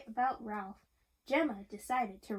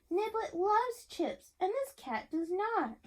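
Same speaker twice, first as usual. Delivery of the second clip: put-on higher, slow kid voice; child voice; 7 semitones higher than usual. A narrator's reading. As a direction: sound like an indignant little boy. Child character voiced in story.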